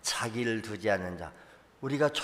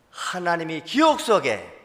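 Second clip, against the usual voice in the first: usual speaking voice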